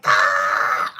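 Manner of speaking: loud, low burst of "Ah"; startled shout. Retold or imitated. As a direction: blurt it out as your knee jerks. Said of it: "I was like".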